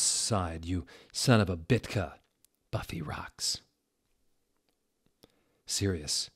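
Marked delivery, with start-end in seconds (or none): 2.7-3.6 unvoiced whisper, higher; whispered aside. Reader whispering a fan aside, unvoiced. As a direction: slip in a secret whisper.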